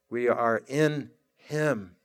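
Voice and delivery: soft, slow and hushed, almost to yourself; a low murmured affirmation, unhurried. Acted. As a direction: let it sink in quietly, drawn out. Soft reflective repeat of phrase.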